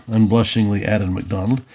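ordinary delivery

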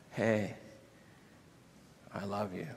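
very soft, gentle reassurance; pause, then almost murmur it lovingly; quiet, forgiving love; merciful tenderness. Acted. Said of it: "Jesus is so merciful".

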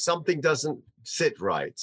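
normal talking voice

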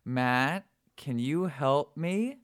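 put-on high, slow little girl's call from behind a door; muffled voice. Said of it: "sort of in this muffled voice".

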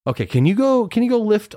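high sing-song teacher voice; coaxing a kid. Retold or imitated. Teacher testing boy's super strength, high coaxing voice.

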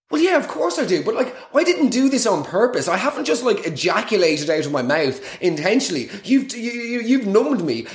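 high-pitched, exasperated self-defence; comic outraged rant; indignant protest. Acted. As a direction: protest your innocence, pitch jumping up. Indignant comic reply to the assistant.

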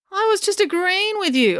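high-pitched protest; high, defensive retort; arguing. A narrator's reading. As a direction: protest your innocence, pitch shooting up. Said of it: "argued Finn".

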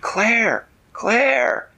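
high, loud repeated name; urgent calling. Acted. Manella calls to Claire in the dragon's claw, urgent shout. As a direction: call out across the sky to a friend.

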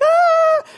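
high, loud mock scream of excitement; freak-out squeal. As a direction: imitate kids freaking out with a squeal. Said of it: "freak out".

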